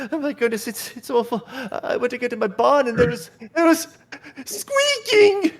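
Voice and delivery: high falsetto, rising on squeaking; upset woman. Acted. Farm woman telling the adventurers about her barn, high and frightened.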